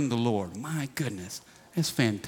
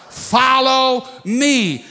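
slow, stretched, higher Follow me; drawn-out answer; 6 semitones higher, 4 dB louder, much slower than usual. Acted. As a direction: draw out "Follow me" like a call to answer. Congregation completes the verse.

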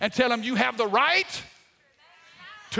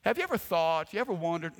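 ordinary delivery

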